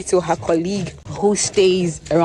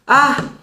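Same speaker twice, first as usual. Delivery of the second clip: high, loud "ah"; sharp little cry of reaction; sudden exclamation; 8 semitones higher, 5 dB louder than usual. Real, not acted. Vlogger reacting to her phone, sudden high exclamation.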